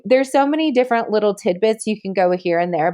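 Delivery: ordinary talk, nothing marked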